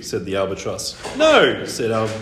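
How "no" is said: loud, high defiant no; firm refusal. Acted. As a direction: refuse like a stubborn boy. Boy refuses firmly.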